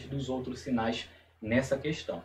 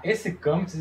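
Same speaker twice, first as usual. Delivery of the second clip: ordinary talk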